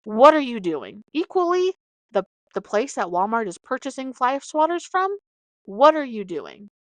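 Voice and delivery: loud, incredulous calling-out; exasperated rant. Real, not acted. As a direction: yell your disbelief at a store. Ranting at Walmart's fly swatters.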